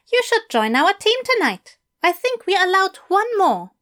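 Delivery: high, eager English teacher; bright, keen invitation from a fussy gentleman. A narrator's reading. Mister Fell voiced higher.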